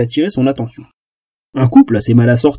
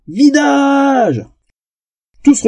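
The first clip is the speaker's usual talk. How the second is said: shout; 11 semitones higher, much slower than usual. Acted. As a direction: yell it out like a bouncer. Barbarian bouncer yells the kick-out.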